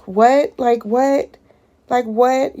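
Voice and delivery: can't believe it, loud and rising; outraged disbelief. Real, not acted. Mother reacting to her son's words, loud outraged disbelief.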